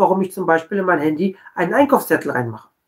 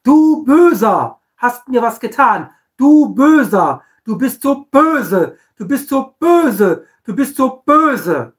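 loud, slow, higher name-calling; mock accusation; 4 semitones higher, 4 dB louder, slower than usual. Condemning someone who wronged you, in a hammed-up accusing voice. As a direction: point the finger and brand them wicked.

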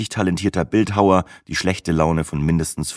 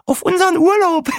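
very high, louder squeal of a toast; laughing Brenda; 5 dB louder than usual. A narrator's reading. Woman toasting the holiday with new friends, laughing. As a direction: squeal it gleefully, breaking into a laugh. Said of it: "lachte Brenda".

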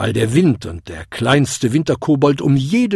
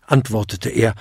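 ordinary delivery, nothing marked

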